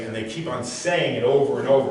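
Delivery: normal talking voice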